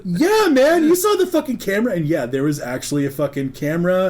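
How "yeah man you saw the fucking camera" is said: loud, high, quick retort; exasperated insistence. Retold or imitated. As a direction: push the obvious point at a friend.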